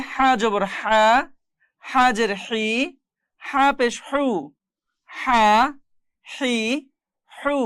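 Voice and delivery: হা, হি, হু squeezed out from the throat; pressed h. Shown as a demonstration. Teacher drilling students on the letter, pressed h in হা, হি, হু. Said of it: "চাপ দিয়ে".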